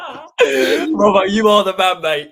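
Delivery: loud, high and laughing; laughing praise. Real, not acted. Host thanking his legendary guest, laughing loudly. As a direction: cheer him through a laugh.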